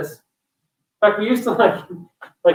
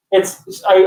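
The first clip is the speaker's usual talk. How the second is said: ordinary talk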